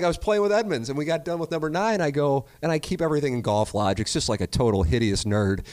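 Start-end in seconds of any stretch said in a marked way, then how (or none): none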